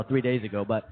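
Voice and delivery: ordinary talk, nothing marked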